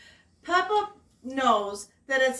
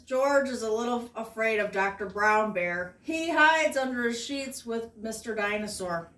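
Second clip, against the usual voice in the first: plain reading voice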